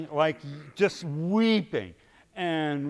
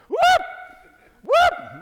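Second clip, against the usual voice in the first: high shrieking whoops, much louder; mock whoop; 9 dB louder than usual. Preacher imitates girl at the salad bar, shrieking whoops. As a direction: yelp it out like she's caught by the Spirit. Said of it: "And she went".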